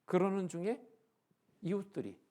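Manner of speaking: ordinary delivery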